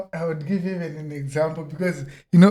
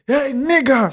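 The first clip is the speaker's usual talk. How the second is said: hostile retold greeting; 8 semitones higher, 5 dB louder than usual. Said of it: "in a way where I had done something wrong".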